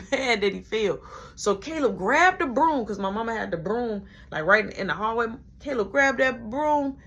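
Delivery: ordinary delivery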